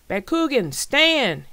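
high, loud battle cry; full-voiced shouted call; yelling. A narrator's reading. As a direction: yell the command to launch the fight. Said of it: "he yelled".

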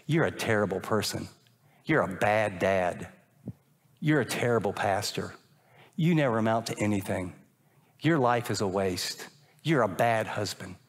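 low, heavy self-accusation; low voice of self-condemnation; inner accusing voice. Retold or imitated. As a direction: voice the lie in your head, low and grim. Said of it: "it goes like this".

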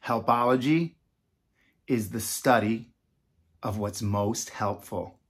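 slow, fading, word by word; slowed-down repeat for the class; slow definition. Shown as a demonstration. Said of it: "I need it slow sometimes".

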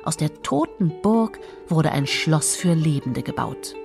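usual speaking voice

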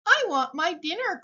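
lightly voiced high little call. A narrator's reading. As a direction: call out as a small hungry one. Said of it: "called the very small".

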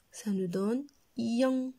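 ordinary talk

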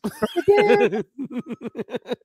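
long giggling burst; hearty laugh. Real, not acted. After singing Brick House joke, hearty laugh.

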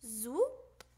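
ordinary delivery

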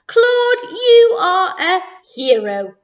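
high, loud, drawn-out proclamation; triumphant cry. Acted. Mayor hailing the dog who saved the day, triumphantly. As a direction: proclaim it slowly, coming down from high. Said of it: "he cried".

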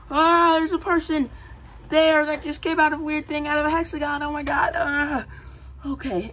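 high, panicky rush; high panicked teen voice; freaking out. Acted. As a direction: voice the kid in a panic. Richard freaking out.

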